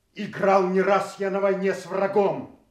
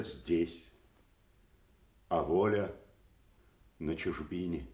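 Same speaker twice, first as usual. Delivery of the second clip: somber farewell; 12 semitones lower, 7 dB quieter than usual. Acted. Banished Kent says farewell.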